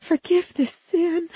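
high, slow, tearful plea; sobbing prayer. A narrator's reading. Principal's wife praying into her hands, sobbing prayer. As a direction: beg forgiveness through sobs. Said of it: "Missus Pinkman sobbed into her hands".

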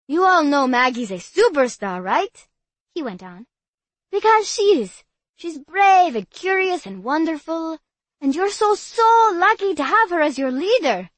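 high, loud, adoring praise; gushing boy voice. Acted. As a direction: gush over her like a fan. Bobby praises Maggie.

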